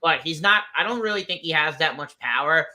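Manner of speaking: ordinary talk, nothing marked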